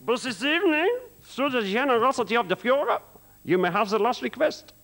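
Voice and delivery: high, shouting German officer voice; German commandant. Retold or imitated. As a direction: bark it like a pompous camp commandant. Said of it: "He says".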